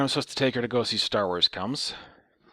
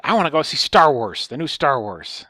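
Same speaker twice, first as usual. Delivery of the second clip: loud, raised mock old lady; old lady impression, pitched up and loud; 6 semitones higher, 9 dB louder than usual. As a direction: imitate an old woman blurting her wish. Retelling old lady's birthday wish.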